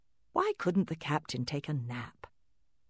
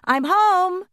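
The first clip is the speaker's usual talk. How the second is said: very high, loud shout; 10 dB louder than usual. Acted. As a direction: shout it from the front door. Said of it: "heard Kate shout".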